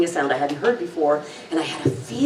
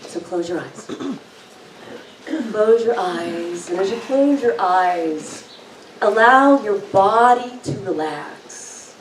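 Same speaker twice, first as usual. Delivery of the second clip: guided meditation; slower than usual. Acted. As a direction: ease them into calm, slowly.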